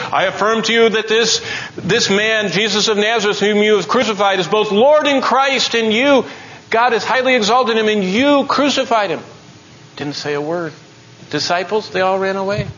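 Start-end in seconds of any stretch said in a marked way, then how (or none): 0.1-9.2 high, forceful proclamation; Peter's bold declaration. Acted. Disciple confronting those who crucified Christ, ringing declaration. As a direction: proclaim it with rising accusation. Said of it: "Peter was gonna say".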